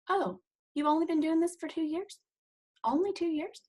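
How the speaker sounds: softly quoted surprise at "two years"; mock disbelief. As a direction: mimic her amused disbelief. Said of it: "That's how she said it to us".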